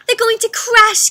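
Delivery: high, loud gasping fear; gasped alarm. Acted. Stellaluna gasps seeing her friends falling. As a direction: gasp it out in alarm. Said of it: "gasped Stellaluna".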